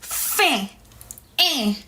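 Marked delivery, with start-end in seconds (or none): 1.4-1.7 EH as a nasal French "ain"; nasal sound. Shown as a demonstration. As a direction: push EH through the nose, higher. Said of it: "It's more of a nasal sound".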